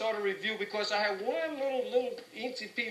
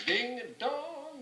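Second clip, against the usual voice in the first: slow, even beats, high; sounding out the old arranged beat; slow tapped-out rhythm; 7 semitones higher, much slower than usual. Shown as a demonstration. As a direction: spell out the tap rhythm slowly. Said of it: "our music arranged to the tap".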